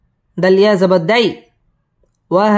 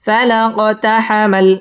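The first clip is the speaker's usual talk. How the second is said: ordinary talk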